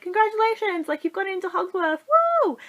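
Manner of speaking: high, mock-celebratory announcement; excited congratulations. Letter to sister turning eleven, excitedly congratulating her.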